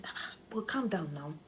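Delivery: slow, soft whispered calming; soothing whisper. Retold or imitated. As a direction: gently hush an upset partner. Soothing husband on call.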